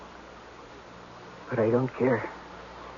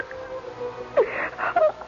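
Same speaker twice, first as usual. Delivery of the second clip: choking sob; 4 dB quieter than usual. Acted. Breaks down on the tape.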